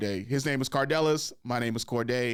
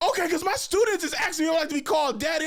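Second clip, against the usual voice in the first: much higher, quick mock brother voice; imitating his brother; 12 semitones higher, faster than usual. Brother explaining his students' question, high fast mock voice. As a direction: rattle it off in a high put-on whine. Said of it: "And he was like".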